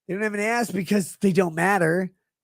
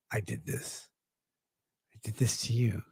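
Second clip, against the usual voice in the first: mock-sinister whispered confession; gloating whisper; 8 semitones lower, 11 dB quieter than usual. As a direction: hiss it to him like a proud villain. Taunting Jim from hell.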